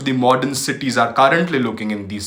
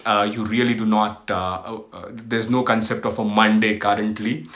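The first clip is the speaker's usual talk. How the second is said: usual speaking voice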